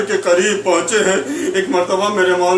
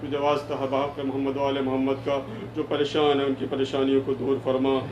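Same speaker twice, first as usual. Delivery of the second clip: low, soft congregational dua; supplicatory prayer; 5 semitones lower, 7 dB quieter than usual. Real, not acted. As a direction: plead with God in a lowered, hushed voice. Leads closing supplication. (دھیمی، نیچی آواز میں عاجزانہ دعا)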